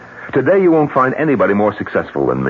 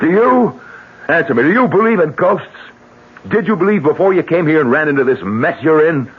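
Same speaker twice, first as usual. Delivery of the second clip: forceful challenge; 9 semitones higher than usual. Acted. Heated demand in argument.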